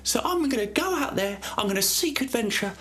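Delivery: put-on very high, squeaky posh kid voice; high childish Thomas. A narrator's reading. Boy telling his mother he wants adventure, very high squeaky voice. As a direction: pipe it up like an eager little boy. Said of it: "he goes to his mother and he says".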